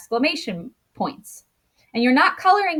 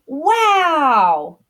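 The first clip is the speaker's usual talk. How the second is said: loud, higher, drawn-out falling wow; excited exclamation; 4 semitones higher, 5 dB louder than usual. Shown as a demonstration. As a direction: exclaim it with strong amazement. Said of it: "might yell or you might say with excitement".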